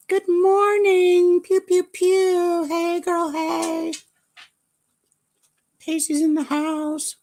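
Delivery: high, slow, singsong hello; cheery high greeting. Retold or imitated. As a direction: greet the room in a bubbly high voice. Voicing friend's greeting.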